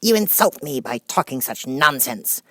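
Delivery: high, loud, huffy refusal; offended Mouse. Acted. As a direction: refuse with injured dignity.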